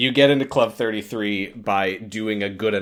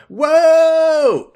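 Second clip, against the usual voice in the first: high, loud startled whoa; surprised whoa; 10 dB louder than usual. Real, not acted. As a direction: blurt it out in surprise. Exclaiming over the article.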